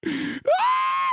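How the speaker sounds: shrieking "Ah!" way up high; high exasperated scream. Real, not acted. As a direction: let out a shrill cry of disbelief. Outburst scream.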